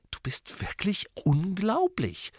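langsam, tonlos, ungläubig geflüstert; ungläubig vor sich hin flüstern; kopfschüttelndes Flüstern. A narrator's reading. Harry kopfschüttelnd. (kopfschüttelnd geflüstert)

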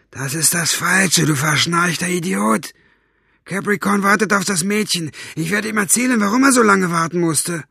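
high, impatient scolding voice; angry berating. Acted. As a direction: threaten a useless underling with reporting him. Basta berates the sleepy guard.

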